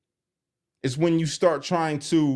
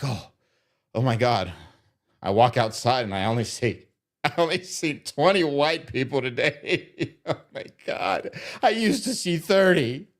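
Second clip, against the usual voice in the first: a hammed-up, gasping caricature, laughing through it; lightly voiced mock alarm. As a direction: play the frightened neighbour, half laughing.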